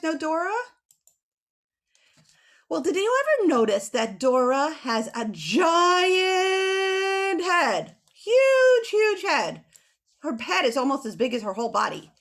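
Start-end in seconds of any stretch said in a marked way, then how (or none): 2.7-8.0 drawn-out singsong wonder; amazed. Shown as a demonstration. Teacher to kids, marvelling at Dora's head in slow singsong. 8.1-9.6 HUGE thrown high and loud; huge emphasised. Shown as a demonstration. Teacher to kids, stressing "Huge" high and loud.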